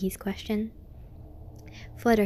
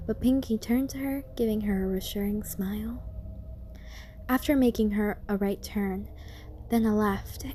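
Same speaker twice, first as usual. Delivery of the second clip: usual speaking voice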